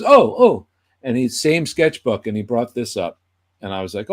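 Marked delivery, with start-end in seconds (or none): 0.0-0.7 higher, louder sudden recall; a quick excited realisation; high eager oh; remembering. Retold or imitated. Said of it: "he goes".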